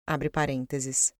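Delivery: low, slow aside voice; dictating. Acted. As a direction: drop the voice to read out the brackets. Reads the brackets aloud.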